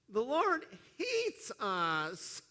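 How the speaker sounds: put-on slow, drawn-out whine of a sulky teen boy; whiny teenager. Preacher mimics Israelites grumbling in tents, whiny teen boy. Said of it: "read this is like a whiny fourteen-year-old boy".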